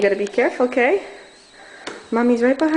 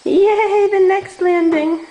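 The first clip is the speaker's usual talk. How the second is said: sing-song praise, drawn out; 7 semitones higher, 4 dB louder, slower than usual. Real, not acted. Cheering toddler climbing stairs.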